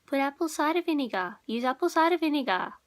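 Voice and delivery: high-pitched imitation of an insistent mum; hammed-up nagging mother. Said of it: "my mum was always crazy when she was".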